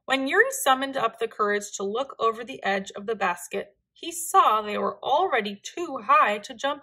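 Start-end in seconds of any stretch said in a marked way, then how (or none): none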